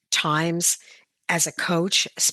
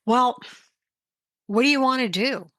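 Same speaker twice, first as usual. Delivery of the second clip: dad voice; 7 semitones higher than usual. Retold or imitated. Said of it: "and he's like".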